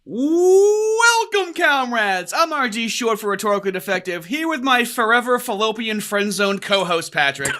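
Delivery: loud, swooping podcast opener; show announcer intro. Acted. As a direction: belt out the welcome like a hype announcer. Performs the show's opening.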